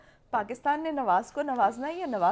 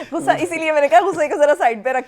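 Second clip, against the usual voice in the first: loud, higher, talking through laughter; laughing; 3 semitones higher, 11 dB louder than usual. Real, not acted. Host asking angry guest to set anger aside, laughing. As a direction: laugh while explaining yourself.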